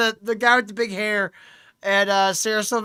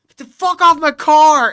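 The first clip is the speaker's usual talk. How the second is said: loud, higher furious shout; loud, higher retold yell; retold angry yell; 7 semitones higher, 13 dB louder than usual. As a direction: yell at the guys on your car. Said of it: "the dude's like".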